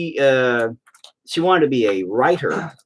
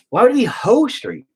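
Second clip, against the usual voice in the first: ordinary delivery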